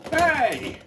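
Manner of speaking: sudden high hey; quick high exclamation; excited cheer. Real, not acted. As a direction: cheer at the result. Cheers at the battle.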